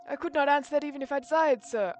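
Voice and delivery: put-on high, loud, testy old servant; crabby old butler. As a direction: refuse like a cranky old butler. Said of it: "crabby old butler voice".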